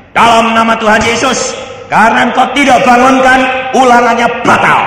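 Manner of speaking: seruan doa bernada tinggi; doa klaim lantang. Retold or imitated. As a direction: klaim dengan penuh tenaga. Doa klaim anak yang diperagakan. (doa anak ditirukan dengan berseru)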